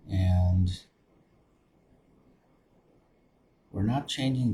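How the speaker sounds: soft, low-key meditation voice with long pauses; guided meditation. Acted. Meditation leader settling listeners into open awareness, guided meditation. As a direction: guide them gently inward, softly, leaving space between phrases.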